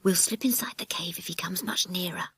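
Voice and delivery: hushed whisper while hiding. Acted. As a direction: whisper it so nobody outside hears. Children hiding from the trippers.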